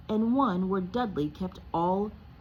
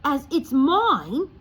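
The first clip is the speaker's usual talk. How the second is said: put-on loud, higher angry boy; furious; 9 semitones higher, 9 dB louder, slower than usual. Harry to Uncle Vernon, furious and loud. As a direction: insist hotly. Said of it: "said Harry furiously".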